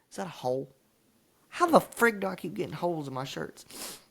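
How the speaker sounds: usual speaking voice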